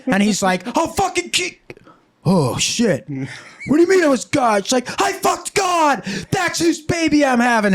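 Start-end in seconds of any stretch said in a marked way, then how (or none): 0.8-1.6 high-pitched threat cut off midway; hammed-up enraged outburst; angry threat. Acted husband's threat, cut off. 2.2-3.1 backing off. Acted. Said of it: "Joseph's just backed the fuck off". 3.7-4.6 high-pitched indignant outburst; acted husband in disbelief; incredulous challenge. 5.0-7.7 hammed-up wife shouting her comeback; defiant yell. Acted wife shouts back in quarrel.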